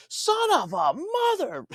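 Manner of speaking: high, drawn-out mock yell; retold outburst. Retired lawyer reacting to the FTC news, imitated explosive outburst. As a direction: imitate an old man erupting, loud and slow. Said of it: "He freezes and he goes".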